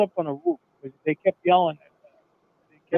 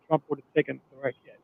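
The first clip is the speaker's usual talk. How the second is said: ordinary delivery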